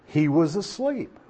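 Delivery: normal talking voice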